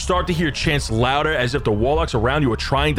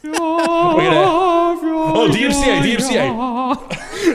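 mock alarm; 3 dB louder than usual. Real, not acted. GM mock warns about music.

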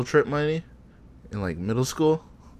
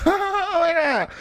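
imitated laughing; much slower than usual. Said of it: "she just dies laughing".

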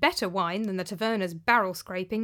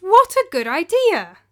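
much higher and louder, slow and gleeful; eager announcement; 10 semitones higher, 11 dB louder, slower than usual. Acted. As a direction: cut in with delight. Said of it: "talked over me and announced".

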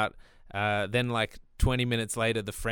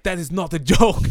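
mock-offended French guy, louder and higher; indignant retort; 4 semitones higher, 7 dB louder than usual. French guy snapping back at the joke, mock indignant. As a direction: bristle at the insult.

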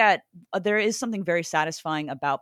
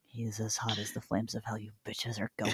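aggressive retort; 9 semitones lower, 12 dB quieter than usual. Acted. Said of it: "Why is he so aggressive?".

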